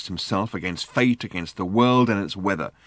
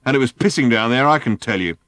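higher, loud complaint; emphatic grumbling; 4 semitones higher, 6 dB louder than usual. A narrator's reading. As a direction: insist on it loudly, aggrieved. Driver rants about the rain.

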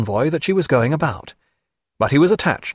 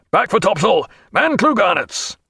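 higher-pitched deck command; shouted order; 8 semitones higher than usual. A narrator's reading. Captain to crew over his shoulder, calling orders. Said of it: "over his shoulder".

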